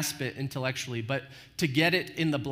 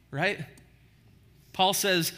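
normal talking voice

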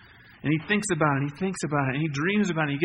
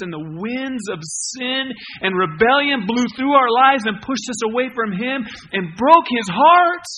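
higher and louder, slow and heavy; preaching climax; 5 semitones higher, 8 dB louder than usual. Acted. Pastor to congregation, loud rising climax about sin. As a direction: let the grief of sin ring out.

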